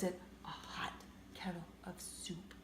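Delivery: low unvoiced whisper. A narrator's reading. Reader whispering the last riddle option. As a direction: hush it like a secret clue.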